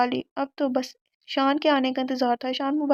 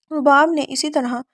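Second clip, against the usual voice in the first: ordinary delivery, nothing marked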